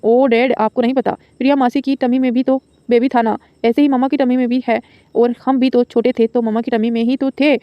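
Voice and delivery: ordinary delivery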